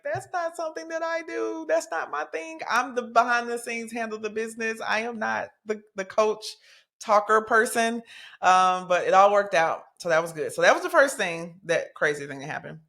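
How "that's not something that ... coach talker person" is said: high-pitched, flustered protest; protesting mom. Retold or imitated. Mom objecting to calling college coaches, high and flustered. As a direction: squeak it out like someone dodging a chore. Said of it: "I'm like".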